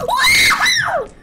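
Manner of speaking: high, loud woohoo; excited whoop. Real, not acted. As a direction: cheer out with joy. Cheering escape.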